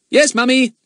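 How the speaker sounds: put-on high, loud well-mannered boy; polite boy voice. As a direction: answer like a good schoolboy. Narrator voices Fatty.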